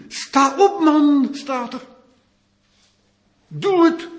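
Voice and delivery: high, loud urging to stand up; urgent command. Acted. Preacher urges Barak to rise and act, high and loud. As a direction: push him to act at once.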